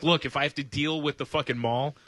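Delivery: ordinary delivery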